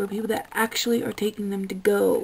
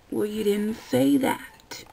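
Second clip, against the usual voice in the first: ordinary delivery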